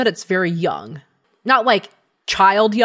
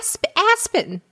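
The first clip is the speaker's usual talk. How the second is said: small, high, stumbling voice; high gnome voice, hesitant; 7 semitones higher than usual. Acted. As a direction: fumble for words like a young gnome. Gnome gives its name.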